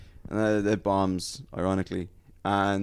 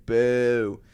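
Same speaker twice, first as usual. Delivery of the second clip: louder, higher drawn-out boo; crowd booing; 4 semitones higher, 5 dB louder than usual. Retold or imitated. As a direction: mimic the crowd jeering. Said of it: "The crowd was like".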